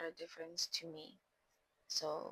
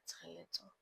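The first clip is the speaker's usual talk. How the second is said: whispered name; 3 semitones lower, 15 dB quieter than usual. Retold or imitated. Telling herself off, aside.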